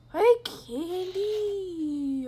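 long, drawn-out high holler; calling out. Pretend. Player hollers for missing Candy, calling out. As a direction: call loudly, stretching the name right out.

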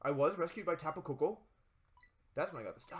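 ordinary talk, nothing marked

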